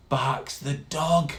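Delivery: usual speaking voice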